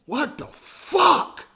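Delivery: high, drawn-out outburst of disbelief; incredulous and exasperated. Retold or imitated. Reacting to friend taking console back.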